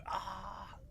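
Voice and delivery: high, soft "ah" of amazement; mock awestruck gasp. Host imitating the meme's final reaction, blissfully amazed. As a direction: imitate a huge leaning-back gasp of awe. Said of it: "the huge, like, you know, lean back".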